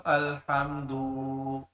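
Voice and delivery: الحمدو with the final و stretched very long and slow; wrong elongated ending. Shown as a demonstration. Tajweed teacher shows a wrong elongated reading. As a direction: drag out the last vowel of الحمدو as a mistake. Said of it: "لمبا کر کے پڑھ دو".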